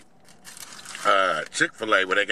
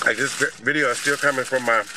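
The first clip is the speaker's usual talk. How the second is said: chewing while talking; talking between bites; mouth full. Real, not acted. Seen 0: Bit the wrapped sandwich at -7s; mouth still working while holding it.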